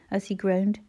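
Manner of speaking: usual speaking voice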